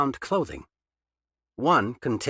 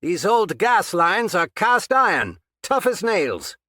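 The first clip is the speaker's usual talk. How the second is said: calling out; 9 semitones higher, 6 dB louder than usual. A narrator's reading. Captor calling from afar.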